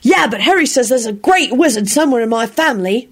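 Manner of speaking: hint at a secret; mysterious. A narrator's reading. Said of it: "Timothy replied mysteriously".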